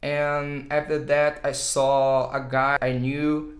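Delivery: I was on the street said slowly, with pauses; slow demo English. Shown as a demonstration. Teacher reading an English example slowly, with uh fillers. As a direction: lay out the sentence slowly like a demo. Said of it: "Por exemplo".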